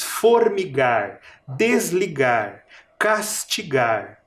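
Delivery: formigar, desligar, castigar read slowly; slow rhyme list. Shown as a demonstration. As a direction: give each igar word time. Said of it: "a vogal mais forte é o I".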